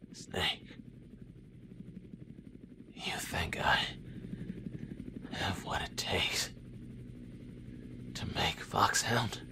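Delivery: wounded, barely voiced; faint injured whisper; weak whisper. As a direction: breathe the words out in pain. Dying soldier asking Snake.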